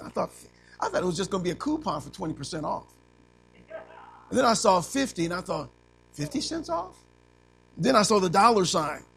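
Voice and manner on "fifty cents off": surprised question. Retold or imitated. Retelling reading a coupon.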